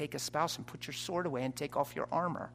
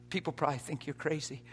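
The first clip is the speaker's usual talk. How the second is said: ordinary talk, nothing marked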